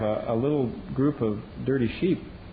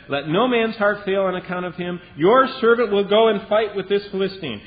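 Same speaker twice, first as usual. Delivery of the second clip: louder, ringing declaration of courage; bold young David; 8 semitones higher, 6 dB louder than usual. Acted. David volunteering to Saul to fight, bold loud declaration. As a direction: announce it, fearless and proud. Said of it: "and he said".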